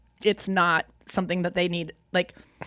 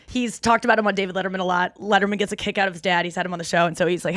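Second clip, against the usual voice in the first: normal talking voice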